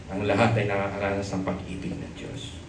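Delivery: ordinary talk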